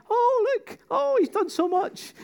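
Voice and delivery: very high mock-weepy gushing; imitating moved onlookers in falsetto; imitated tearful admiration. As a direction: coo it in a squeaky, overcome voice. Voicing the tearful, moved contestants.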